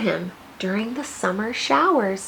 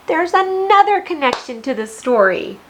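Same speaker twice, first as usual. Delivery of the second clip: excited; 6 semitones higher, 4 dB louder than usual. Real, not acted. Teacher announces a connection.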